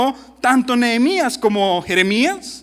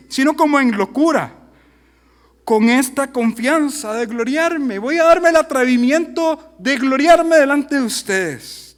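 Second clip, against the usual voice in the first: sarcastic; 4 semitones higher, 4 dB louder than usual. Acted. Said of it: "Aquí el sarcasmo llegó a su cúspide".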